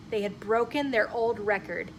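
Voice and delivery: ordinary delivery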